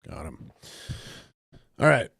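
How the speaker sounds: normal talking voice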